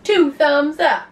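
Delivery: big, loud, drawn-out cheer; high-pitched hurrah, stretched out slowly. Real, not acted. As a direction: call it out like a proud fan. Cheering her husband on.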